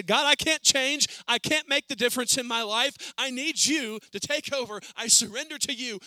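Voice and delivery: loud, high, pleading cry to God; pleading prayer. Pretend. Preacher voicing a listener's prayer, loud pleading cry.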